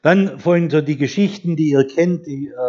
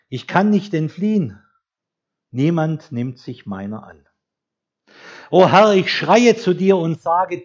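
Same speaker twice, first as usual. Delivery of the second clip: solemn psalm reading; 2 semitones higher, 4 dB louder than usual. Acted. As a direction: read it as a heartfelt lament to God. Reads a psalm aloud.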